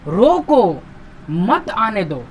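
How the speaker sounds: drawn-out "रोको", break, then "मत आने दो"; long रोको as its own command; pause after रोको. Shown as a demonstration. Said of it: "यहां देखिए क्या कह रहा है".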